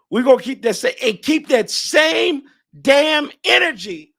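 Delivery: rally them with the catchphrase; hyped up. Real, not acted. Hyping fans, catchphrase.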